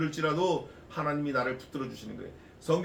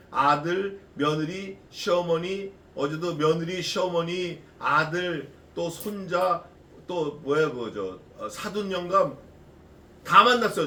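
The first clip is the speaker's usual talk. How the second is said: ordinary talk, nothing marked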